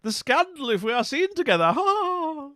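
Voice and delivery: high, loud, scandalised period-drama lady; Pride and Prejudice heroine. Pretend. Playing a lady refusing Mister Darcy's affections, hammed-up scandalised protest. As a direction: ham up a flustered costume-drama refusal. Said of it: "Is it sort of like Pride and Prejudice".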